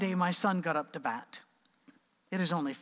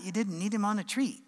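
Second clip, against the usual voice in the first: ordinary talk, nothing marked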